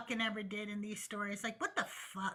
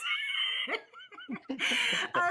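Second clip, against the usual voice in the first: laugh; 10 semitones higher than usual. Real, not acted. Laughing at own bit.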